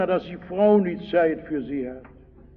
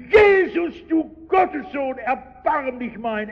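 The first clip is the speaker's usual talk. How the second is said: much higher pleading shout, slowing at the end; even louder cry; 7 semitones higher than usual. Retold or imitated. Blind beggar shouting over the crowd to Jesus, high pleading cry. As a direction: keep crying out, refuse to be silenced. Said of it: "er brüllt nur noch mehr".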